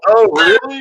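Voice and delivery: high, loud fake surprise; mock surprise. Son feigns surprise to his mom on phone, high and loud. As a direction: gush out a surprised oh really. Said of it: "And I go".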